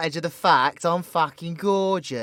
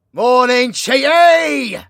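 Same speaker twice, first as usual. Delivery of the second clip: loud, higher good-morning call; sing-song wake-up holler; loud cheery wake-up; 6 semitones higher, 10 dB louder, slower than usual. Acted. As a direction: boom it to get them out of bed. Waking the listener up.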